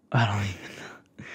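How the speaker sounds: breathy whisper through a little laugh. Real, not acted. Flustered while telling her date she's proud, whispering with a laugh. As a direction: laugh it off under your breath.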